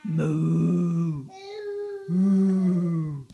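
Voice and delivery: loud playful mooing; cow moo. Acted. Imitating a cow for toddler.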